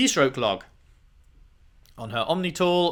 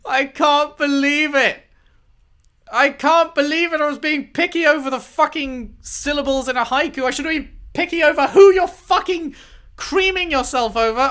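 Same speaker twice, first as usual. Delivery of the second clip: outraged disbelief; 12 semitones higher, 6 dB louder than usual. Real, not acted. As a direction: rant at her in betrayed disbelief.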